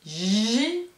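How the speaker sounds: "Girafe" with a long buzzed "j", then a pause before "raf"; slow teaching "Girafe", jjji... raf; stretched J, split syllables. Shown as a demonstration. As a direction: draw out the first sound of "Girafe" and separate "raf". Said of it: "si le bruit du jet d'eau J se trouve".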